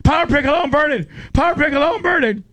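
high squealed safe word; mock panic. Lummy yelling the safe word under the bear, high mock panic. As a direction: squeal it over and over. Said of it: "so Lummy was like".

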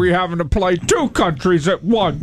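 high mock complaint; mock whine. Joking complaint.

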